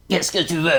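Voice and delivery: first character voice. Shown as a demonstration. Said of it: "je vais faire".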